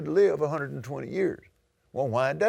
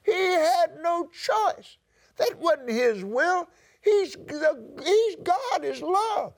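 high, strained voice close to crying; a catch of real tears as he pleads; tearful preaching; slower than usual. Real, not acted. Preacher near tears telling the congregation God is love, high and crying. Preacher overcome mid-sermon.

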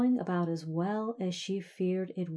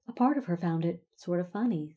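plain reading voice

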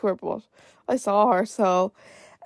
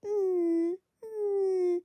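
much higher, softer imitated whimper; dog whining; 10 semitones higher, 7 dB quieter than usual. Dog waiting up late, imitated high soft whine. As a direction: whimper like a needy puppy at night. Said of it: "he'd be whining".

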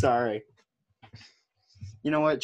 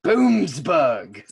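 mock British place name; 3 semitones higher, 5 dB louder than usual. Playing with silly town names.

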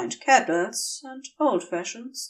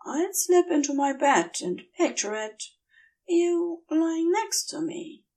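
higher, hesitant and earnest; tender angel confession; 7 semitones higher than usual. A narrator's reading. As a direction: offer yourself shyly, voice pitched up. Aziraphale's confession.

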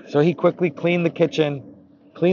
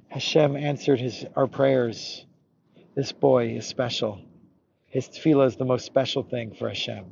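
softer, hushed sincerity; tender; 6 dB quieter than usual. Acted. Baal Shem Tov honouring the boy's prayer, tender. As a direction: let it settle gently, with reverence.

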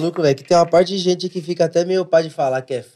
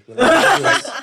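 laughing plea; 12 semitones higher than usual. Retold or imitated. Retold plea to girlfriend.

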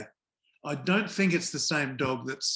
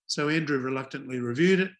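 ordinary talk

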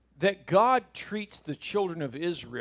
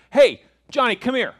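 put-on loud, higher call to a child; yelling parent; 7 semitones higher, 10 dB louder than usual. Parent calling Johnny, mock yelling. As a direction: holler for a kid who ignores you. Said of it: "the parents that are like".

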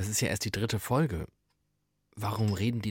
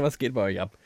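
ordinary talk, nothing marked

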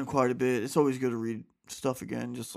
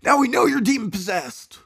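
higher exclaiming retake; 11 semitones higher, 11 dB louder than usual. A narrator's reading. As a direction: exclaim it up high, accusing. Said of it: "I'm sorry".

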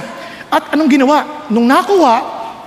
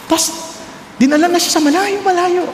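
usual speaking voice

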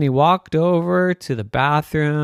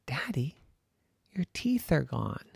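soft, hushed news; children quietly telling daddy his teeth are gone; 11 dB quieter than usual. Acted. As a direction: break it gently, lowered voice. Kids break bad news.

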